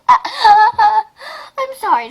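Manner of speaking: slow, high laughing apology. Real, not acted. Girl cracking up at her story, laughing slowly. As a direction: wheeze through the sorry. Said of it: "this is funny".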